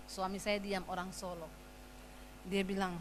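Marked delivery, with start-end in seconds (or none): none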